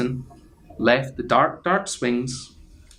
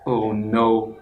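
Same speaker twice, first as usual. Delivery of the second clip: usual speaking voice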